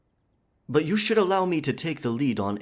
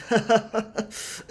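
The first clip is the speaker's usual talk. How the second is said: high hammed-up pirate chuckle; hearty fake pirate laugh; 12 semitones higher than usual. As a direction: laugh it off like a jolly buccaneer. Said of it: "the pirate speak".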